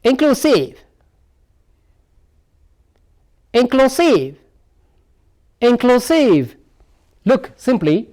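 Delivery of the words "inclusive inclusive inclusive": "Inclusive" pitched high, then dropping at the end; "Inclusive" as a careful word drill; stressed-syllable demo. Shown as a demonstration. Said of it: "The stress lies on here now".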